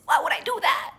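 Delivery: high, whispery squeal of disbelief; mock-incredulous protest. Denying she pranked him, high whispery protest. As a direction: pitch it up high and let the voice thin out.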